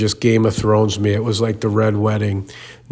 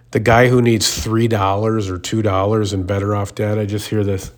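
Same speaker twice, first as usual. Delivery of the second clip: ordinary delivery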